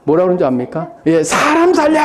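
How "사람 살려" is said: high, strained cry for help; bear crying for help. Retold or imitated. Bear trapped in the tiger cage, crying out for help in a high voice. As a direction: yelp it out like a panicked circus bear. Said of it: "막 외치는 거예요, 곰이".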